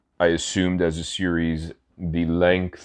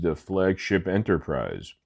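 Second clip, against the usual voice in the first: ordinary talk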